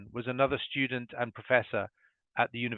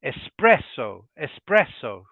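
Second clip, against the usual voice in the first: espresso with the stress in the middle, es-PRES-so; weight on PRES in espresso; 5 dB louder, slower than usual. Shown as a demonstration. Teacher models the Italian stress: es-PRES-so. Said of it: "the stress is in the middle".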